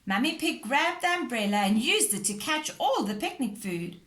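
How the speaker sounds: usual speaking voice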